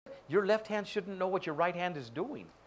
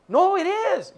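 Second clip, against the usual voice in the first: high and much louder protest; hammed-up insistence; insistent fake cheer; 13 semitones higher, 10 dB louder, slower than usual. Acted fake cheer in dialogue.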